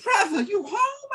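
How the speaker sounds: high, slow woman's voice asking; Sister Lisa's voice. Retold or imitated. Teller voices Sister Lisa asking.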